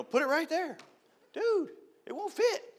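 high, slow voice; mock customer. Customer pointing to a tiny spot, high and slow. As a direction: whine it up high, slowly.

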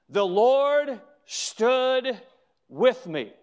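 very slow, weighty, each word held out; drawn-out, ringing declaration; emphatic scripture quote. Acted. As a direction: proclaim it slowly, pausing before the last words. Repeats the verse for the congregation.

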